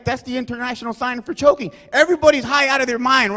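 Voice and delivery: ordinary talk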